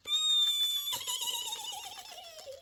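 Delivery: high, wavering little squeal; long fluttery high-pitched giggle-squeal; excited squeal. Acted. Butterfly thrilled with her Easter egg, excited squeal.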